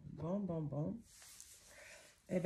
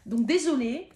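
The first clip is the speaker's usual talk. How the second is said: laughing, louder and higher on désolée; laughing apology; 5 semitones higher, 8 dB louder than usual. Real, not acted. Card reader laughing off her runny nose, apologizing.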